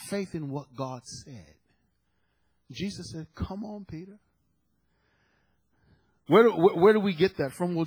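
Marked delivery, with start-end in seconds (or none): 3.3-4.2 hushed, higher-pitched beckoning; quiet, light acted invitation; soft coaxing. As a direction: gently coax him closer, trailing softer. Voices Jesus coaxing Peter to come.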